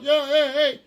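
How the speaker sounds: high, loud hey-hey holler; catcalling yell. Retold or imitated. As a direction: imitate yelling out at a woman. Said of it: "when you yell out".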